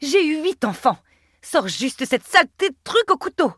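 high, strained labour outburst; cry of pain. A narrator's reading. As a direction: snap through a spasm. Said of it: "en proie à un nouveau spasme".

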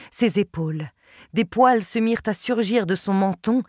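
plain reading voice

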